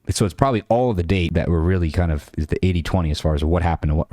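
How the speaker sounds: ordinary talk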